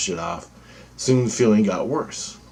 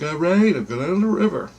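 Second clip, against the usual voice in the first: exasperated giving in; 9 semitones higher than usual. Retold or imitated. Giving in to inner voice.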